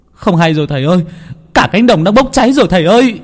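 high, fast panicked plea; panicked servant. Acted. Servant cries to master in panic, high and frantic. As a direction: blurt it out in terror to the master.

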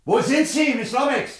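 loud, high call through the house. Acted. Doctor searching the smoky house for Miss Lomax, calling loud. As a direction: shout for her through the smoke.

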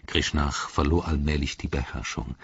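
usual speaking voice

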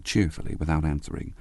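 plain reading voice

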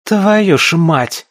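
loud, high, drawn-out curse; swearing out loud. A narrator's reading. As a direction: curse aloud, unable to hold it back. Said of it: "выругалась уже вслух". (громко выругаться в сердцах)

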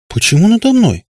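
loud, high protesting cry; возглас. A narrator's reading. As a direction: exclaim in startled protest. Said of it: "воскликнула Катя". (громко, высоко восклицая)

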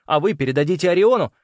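high, pressing plea; urgent bargaining. A narrator's reading. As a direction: bargain for your life. Boy bargains for his life.

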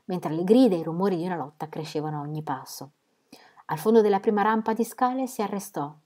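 usual speaking voice

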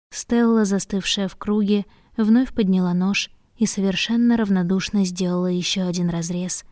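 ordinary delivery

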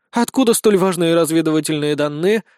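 plain reading voice

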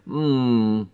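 long, appreciative hum; drawn-out "mmm". A narrator's reading. Pat savours Ellery's kiss, a drawn-out hum. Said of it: "протянула Пэт".

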